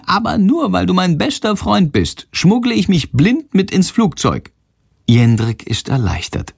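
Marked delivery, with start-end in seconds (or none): 0.1-4.5 bat voice. Acted. Bat Shandor speaks.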